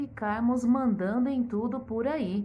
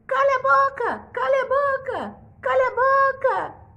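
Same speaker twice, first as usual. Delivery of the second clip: very high, loud squawking parrot; a shrill bird repeating the king; 7 dB louder than usual. Acted. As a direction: screech it like an angry parrot. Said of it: "o papagaio".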